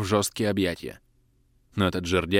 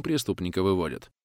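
plain reading voice

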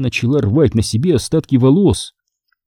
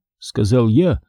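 ordinary delivery, nothing marked

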